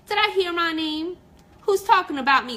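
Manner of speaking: high, loud cranky gripe; griping. Acted. Crabby Cornelia griping at the owl and bunny. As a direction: gripe like a crabby old lady. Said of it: "Crabby Cornelia griped".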